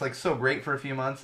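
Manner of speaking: ordinary talk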